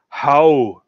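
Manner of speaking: attempted Scottish accent, drawn out and loud; Drinker. Shown as a demonstration. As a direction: have a go at a Scottish "How?". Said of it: "as the drinker would say it".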